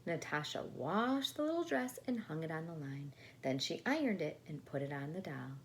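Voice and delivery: usual speaking voice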